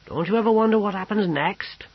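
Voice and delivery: curious cook wondering aloud; lightly voiced cook. A narrator's reading. Mealy asking the courtier, lightly voiced cook.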